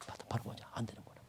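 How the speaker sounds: sinking to a whispered hush. Real, not acted. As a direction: let it fall to a bare whisper. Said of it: "drops to hushed whisper".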